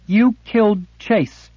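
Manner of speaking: put-on high, loud accusing girl's voice; young girl's angry accusation. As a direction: hurl it at him, high and bitter. Girl accuses her captor.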